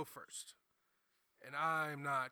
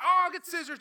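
eager; 16 dB louder than usual. Retold or imitated. Coworkers eagerly offering scissors.

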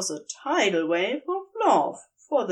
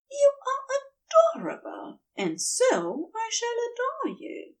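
higher, whispered angel voice; adoring whisper; 13 semitones higher than usual. A narrator's reading. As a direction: breathe the devotion out like a secret. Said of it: "he said, after rendering Crowley breathless".

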